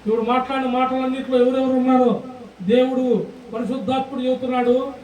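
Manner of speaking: ordinary talk, nothing marked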